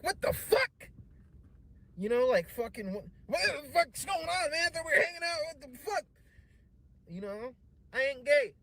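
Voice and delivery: high, loud, shocked yelling; outraged yelling. Retold or imitated. Young man yelling at the host who made a pass. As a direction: yell in disbelief.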